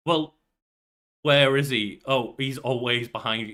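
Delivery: usual speaking voice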